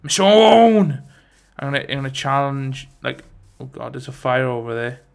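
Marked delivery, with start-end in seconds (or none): 0.0-1.0 calling out. Real, not acted. Calling character in game.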